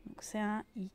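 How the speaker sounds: ordinary delivery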